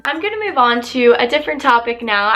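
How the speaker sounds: ordinary talk, nothing marked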